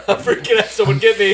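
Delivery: mock panic. Sailor retelling his nerves at lunch, hammed-up panic. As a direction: ham up the freak-out. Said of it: "I was like".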